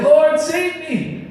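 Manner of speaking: high, drawn-out mock plea; acted cry for help. Sinking Peter crying out to Jesus, high acted plea. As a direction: wail it slowly, high, as if going under.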